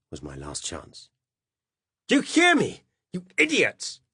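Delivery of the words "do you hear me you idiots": loud, high, desperate yell; breathless shout. Acted. Narrator desperately calling the cops after him, breathless shout. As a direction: shout while out of breath from running. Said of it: "I shouted breathlessly".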